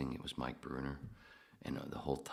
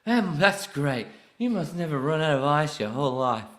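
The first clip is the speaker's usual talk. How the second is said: high, loud put-on tipsy-film voice; playful Arthur impression; 11 semitones higher, 14 dB louder than usual. Arthur answering a man who never drinks, high loud impression.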